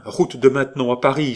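an excited cry of recognition; eager exclamation. A narrator's reading. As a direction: blurt it out, delighted. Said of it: "s'écria Hector".

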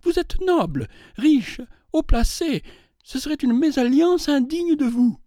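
heated, rising protest; growing animated. A narrator's reading. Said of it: "s'animant à mesure qu'elle parlait".

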